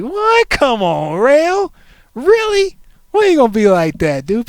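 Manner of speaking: high, loud disbelief; incredulous fan. Retold or imitated. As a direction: yelp of disbelief at his coolness. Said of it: "It's like".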